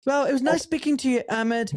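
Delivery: high-pitched mock politeness; imitated evasive director. Director awkwardly ending the call, acted.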